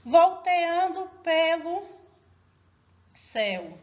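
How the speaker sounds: slow syllables, high then dropping on the last; scanning the verse. Shown as a demonstration. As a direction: hold each syllable apart for counting. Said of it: "então eu vou contar com ela".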